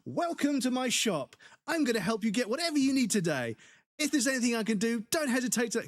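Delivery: high, gushing welcome patter; pantomime shop greeter. Retold or imitated. Mocking overeager shop assistant greeting a customer. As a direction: lay the friendliness on thick. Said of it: "this pantomime of".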